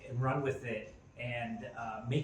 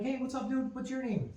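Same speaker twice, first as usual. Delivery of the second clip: much higher, bright upbeat hello; friendly excited greeting; 12 semitones higher than usual. Retold or imitated. Dad greeting son's friend, upbeat high voice. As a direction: greet a little kid cheerfully. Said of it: "And I'm like".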